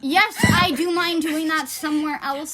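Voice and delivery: mock-outraged retort, retold; indignant retort. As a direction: snap back pointedly, as if offended. Retort to candy shop employee, retold.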